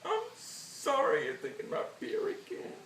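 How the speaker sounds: high, squeaky mock-tearful apology; breaking into tears. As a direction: apologise as the fake tears take over. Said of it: "I wouldn't cry".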